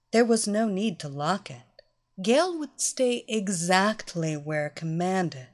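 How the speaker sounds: ordinary delivery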